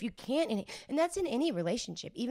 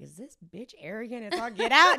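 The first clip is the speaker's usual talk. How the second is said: mock disbelief; 3 semitones higher, 11 dB louder than usual. Voices critics' reaction, laughing.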